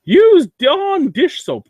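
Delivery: very high, loud, stretched-out silly voice; slow high-pitched mockery; mocking internet advice. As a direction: parrot a dumb tip at half speed, way up high. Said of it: "There was another one".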